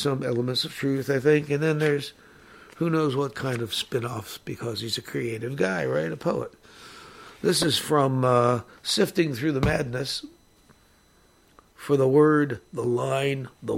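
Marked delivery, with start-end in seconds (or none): none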